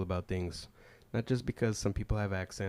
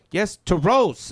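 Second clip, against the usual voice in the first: put-on much higher, louder accented insistence; passenger insisting; 9 semitones higher, 12 dB louder, slower than usual. Passenger insisting on her destination, higher and louder. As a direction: insist firmly and high.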